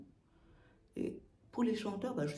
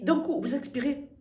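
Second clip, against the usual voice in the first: usual speaking voice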